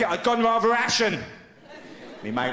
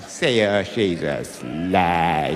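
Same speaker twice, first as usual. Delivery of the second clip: very low, slow, drawn-out sneer; old-fashioned gossip; 13 semitones lower, much slower than usual. Retold or imitated. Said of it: "people used to say".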